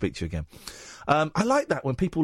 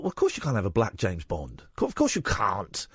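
a hint of a stubborn old self; 9 semitones lower than usual. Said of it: "for a long time thought".

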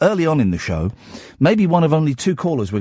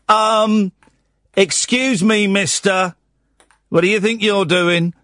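mock stern dad; 7 semitones higher, slower than usual. Dad catches son weeing outside.

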